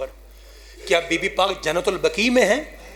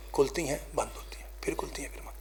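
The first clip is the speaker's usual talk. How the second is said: hushed whisper over the dying man; a trembling whisper at the deathbed; 3 semitones lower, 13 dB quieter, faster than usual. A narrator's reading. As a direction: breathe the words without voice, barely audible. Dying Ali's eyes, hushed telling.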